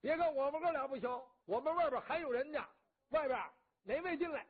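loud, high, fast challenge; Qi Biao shouting a challenge to the onlookers, loud and fast. Acted. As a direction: bellow the dare at the outsiders. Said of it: "齐彪又喊上了".

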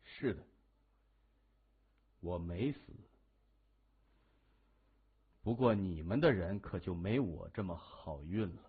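low, unhurried menace; soft-spoken and deadly sure; cool, composed hero. A narrator's reading. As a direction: answer calmly in a low, quiet voice.

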